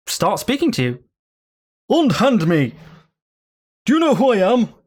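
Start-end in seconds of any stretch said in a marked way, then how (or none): 1.9-4.7 haughty demand from a living sword; a touch of outraged nobility; indignant sword. Acted. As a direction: demand to be let go, affronted. Sword speaks to Chester angrily.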